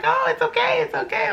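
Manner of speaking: high, soothing it's okay; calm her down like a kind midwife; reassuring midwife. Retold or imitated.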